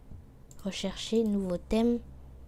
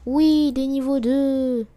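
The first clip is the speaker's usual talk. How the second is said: loud, higher, drawn-out celebration; excited cheer; 4 semitones higher, 8 dB louder, slower than usual. Real, not acted. Streamer celebrating level twos, loud excited cheer. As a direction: stretch the words out in a loud cheer.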